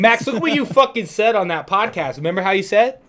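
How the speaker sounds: loud, high, worked-up retelling; excited outburst. Retold or imitated. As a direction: act out crowing to a friend over a called shot. Acts out excitedly telling his friend.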